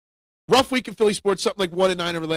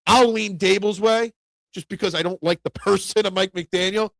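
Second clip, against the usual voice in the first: ordinary talk, nothing marked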